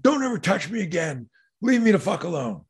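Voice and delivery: heated, high-pitched warning, retold; angry warning. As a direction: act out a student telling off his bully. Student re-enacts warning to bully.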